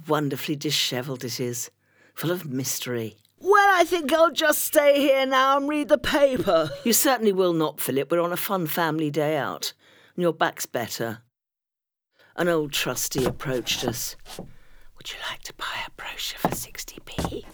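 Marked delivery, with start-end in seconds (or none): 3.4-6.7 much higher, louder put-on husband voice; lazy husband. Philip voiced by narrator. 15.0-17.4 put-on whispered, much softer old trustee.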